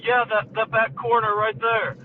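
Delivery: put-on kid. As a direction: lightly voice a kid owning up, slowly. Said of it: "this kid walks up to me".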